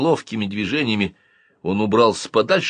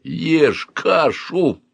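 slow, raised-pitch furious order; furious growl; 7 semitones higher, much slower than usual. A narrator's reading. Uncle at gunpoint forces breakfast, furious growl. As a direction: growl it out word by word. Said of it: "яростно прорычал он".

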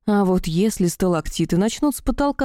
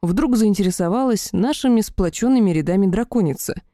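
ordinary delivery

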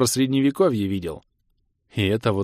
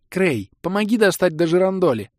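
put-on higher, louder girlish call; girl asking for help with the lamp; 8 semitones higher, 4 dB louder than usual. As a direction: call up to the ceiling lamp eagerly. Girl voiced calling out.

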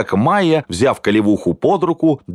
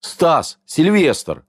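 wooden, higher-pitched woman's greeting; 6 semitones higher, much slower than usual. A narrator's reading. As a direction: say the names stiffly, pitched up. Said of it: "сказала Майя деревянным голосом". (деревянным голосом)

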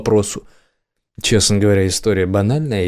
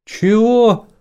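stunned, much higher "what?"; ошарашенно; 13 semitones higher, 4 dB louder than usual. A narrator's reading. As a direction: blurt it out in shock. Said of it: "ошарашенно переспросил лейтенант". (ошарашенный вопрос, резко выше)

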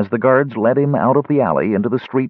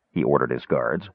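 usual speaking voice

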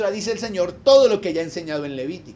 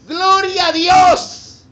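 slow, high, loud cry of praise; praise exclamation; 10 semitones higher, 11 dB louder, much slower than usual. Real, not acted. Preacher praising God before the congregation, high and loud. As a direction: lift it up, drawn out and loud.

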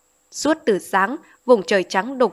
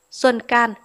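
ordinary delivery

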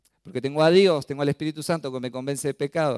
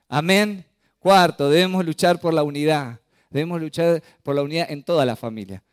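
fervent preaching; 3 semitones higher, 6 dB louder than usual. Acted. Preaching at full force.

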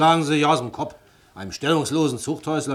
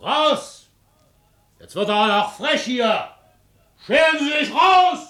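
furious shout; 12 semitones higher, 4 dB louder, slower than usual. Acted. Police official ordering the ex-convict out, furious shout. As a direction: bellow it out, much higher and louder, slowly.

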